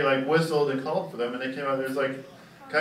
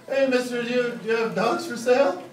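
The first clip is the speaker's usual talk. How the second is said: put-on lightly raised kid's voice; 6 semitones higher than usual. Comic telling a roast, voicing the kid asking for a dog. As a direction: ask like a hopeful little kid.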